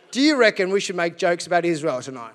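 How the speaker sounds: ordinary delivery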